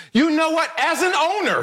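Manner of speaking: high, mock-pompous owner voice. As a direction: ape the owner playing good cop. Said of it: "he says this".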